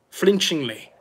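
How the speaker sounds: ordinary delivery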